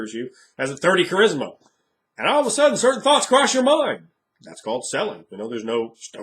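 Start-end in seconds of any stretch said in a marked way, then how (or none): 2.2-4.1 very high, loud sing-song; mock sudden realization. Player teased about a sudden attraction, high mock alarm. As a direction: blurt it high and loud, drawing out the words.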